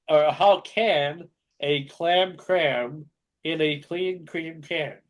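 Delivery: very slow, word by word. Shown as a demonstration. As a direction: drag each word out. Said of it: "Why are you saying green".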